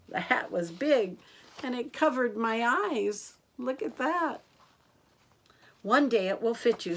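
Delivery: put-on lively picture-book narration for children; story reading. A narrator's reading. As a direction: read it out like a bedtime story for kids. Reads picture book aloud.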